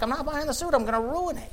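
high, soft mock protest; lightly acted refusal; retold argument. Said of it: "got an argument".

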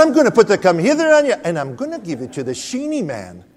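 high, loud mock Irish grandmother threat; grandmother's threat. Grandmother threatening the little boy, mock high loud voice. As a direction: hams up his grandmother warning him, pitched high and loud.

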